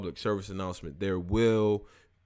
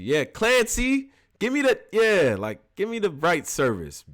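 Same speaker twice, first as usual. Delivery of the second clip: mock demand; 8 semitones higher, 4 dB louder than usual. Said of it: "Tone going crazy".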